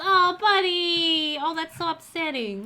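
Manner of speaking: high wail of dismay, easing off; sympathetic dismay. Real, not acted. Player seeing John burnt the hamburgers, wailing in sympathy. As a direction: lament loudly, then soften into pity.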